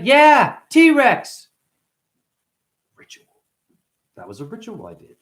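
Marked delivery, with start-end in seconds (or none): 0.0-1.5 put-on little kid voice. Kid's reply voiced.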